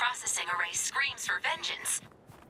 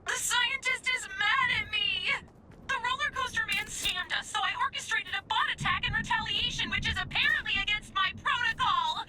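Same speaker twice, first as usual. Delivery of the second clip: higher-pitched, distressed complaint; upset wail; 7 semitones higher than usual. Acted. Robot confessing the scientist is mad, upset wail. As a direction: wail it out like a scolded assistant. Distressed over being in trouble.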